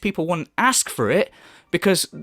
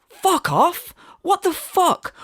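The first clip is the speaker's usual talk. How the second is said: outraged disbelief; 11 semitones higher, much slower than usual. Real, not acted. Outraged reaction to streamer.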